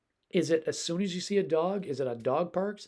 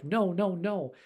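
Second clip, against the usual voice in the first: scolding no; 5 semitones higher, slower than usual. Shown as a demonstration. Owner scolding the dog, a mild repeated no. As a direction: snap out a repeated no. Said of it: "We do it a thousand times".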